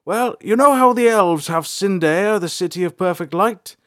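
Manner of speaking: high, loud, bright character voice; smiling Bolthar. A narrator's reading. Bolthar smiling as he explains the Umbra to April. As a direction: explain it with a grin. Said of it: "he smiled".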